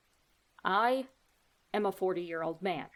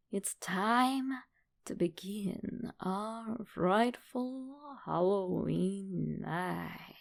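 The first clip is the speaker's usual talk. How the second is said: spooky slow announcement; much slower than usual. Acted. Host spookily opening the story.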